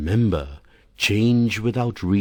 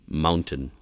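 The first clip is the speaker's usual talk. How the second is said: ordinary talk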